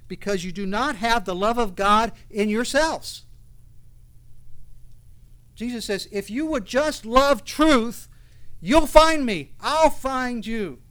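loud, high, slow impassioned preaching; fiery preaching. Acted. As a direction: proclaim Jesus' words with force. Preaching at full force.

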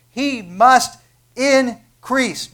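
slow, loud, drawn-out must; stress on must. Real, not acted. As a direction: lean hard on must. Emphatic sermon climax.

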